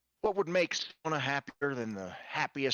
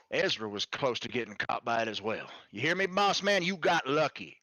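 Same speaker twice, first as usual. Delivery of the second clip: usual speaking voice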